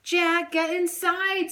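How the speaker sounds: high calling voice of a big sister; calling yell. A narrator's reading. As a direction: call him in from the storm. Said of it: "Sophie yelled".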